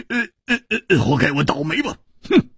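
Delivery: stammering, resigned huff; splutter, then grumble and snort; sulky grumble. A narrator's reading. Man grumbles at his loss.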